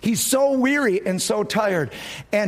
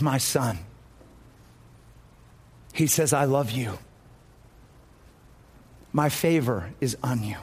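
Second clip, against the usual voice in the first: tender Jesus; 7 semitones lower, 3 dB quieter than usual. Acted. Jesus speaks tenderly to the man.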